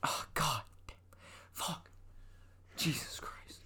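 soft unvoiced swearing; whispered cursing. Real, not acted. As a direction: curse under your breath. Muttering curses, whispered.

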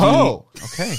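high, loud surprised yelp; startled exclamation; surprised shout. Real, not acted.

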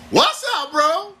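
very high, drawn-out greeting; exaggerated high-pitched bro greeting.

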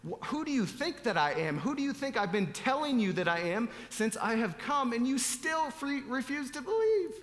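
exasperated questions building to a high, strained finish; sarcastic exasperation. Acted. Preacher acts out Jesus asking who they think he is, sarcastically exasperated. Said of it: "some sarcasm in it".

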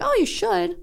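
high, lightly reproachful; mild scolding. Retold or imitated. Scolding family for not tipping, high and light. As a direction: gently tell them off. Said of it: "I'm like".

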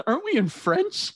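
high-pitched baffled retort; incredulous. Retold or imitated. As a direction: protest up high, amused and baffled.

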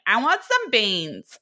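put-on slow whiny valley girl stomach voice. Voicing a friend's rumbling stomach as a valley girl. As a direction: drawl it like a spoiled valley girl. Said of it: "valley girl".

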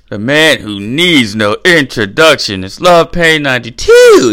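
booming slow announcer voice; announcer intro. Acted. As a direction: hype him up like an arena announcer. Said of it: "even on the P A system".

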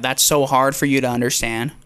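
ordinary talk

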